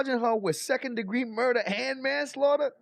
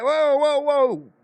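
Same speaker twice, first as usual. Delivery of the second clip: loud, higher shocked "whoa whoa whoa"; mock shocked whoa; 5 semitones higher, 8 dB louder, much slower than usual. Reacting to the judge's accent, loud startled whoa. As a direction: blurt it out like you just caught something odd. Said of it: "we're like".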